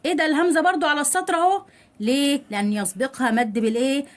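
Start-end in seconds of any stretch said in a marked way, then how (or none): none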